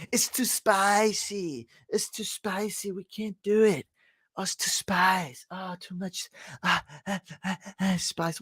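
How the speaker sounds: mock the picky complainers; mock whiny complainer. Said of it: "They're complaining".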